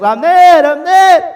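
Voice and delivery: loud high calls; shouting from afar. Retold or imitated. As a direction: yell the name across the hills. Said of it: "David estaba gritando a lo lejos".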